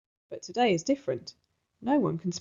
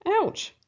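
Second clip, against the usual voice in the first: high yelp of pain; squeaky pained ouch; sharp ouch; 11 semitones higher than usual. Acted. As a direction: yelp as if kicked. Rose kicked.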